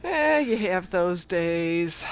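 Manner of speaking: long drawn-out shrugging eh; slow resigned drawl. Real, not acted. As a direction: stretch it out, resigned. Consoling after a botched match.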